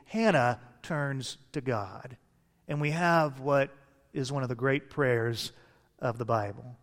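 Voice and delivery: ordinary talk, nothing marked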